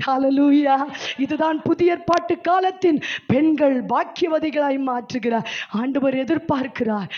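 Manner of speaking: ordinary talk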